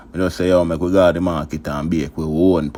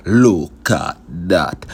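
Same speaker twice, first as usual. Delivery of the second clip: drawn-out, louder proud catchphrase; slow catchphrase; 5 dB louder, much slower than usual. Acted. Cook showing viewers the dish, slow proud catchphrase. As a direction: stretch every word slowly, showing off the dish.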